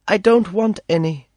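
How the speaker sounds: put-on high young girl's voice, refusing. A narrator's reading. Girl refusing the captive's toys, high girlish voice.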